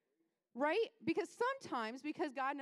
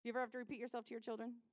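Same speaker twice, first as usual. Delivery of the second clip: normal talking voice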